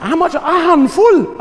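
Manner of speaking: high-pitched questioning, building to a loud drawn-out answer; exasperated exchange with mum. Retold or imitated. Comic retelling of asking mum about measures, exasperated. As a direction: act out a squeaky, exasperated family exchange. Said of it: "and I said".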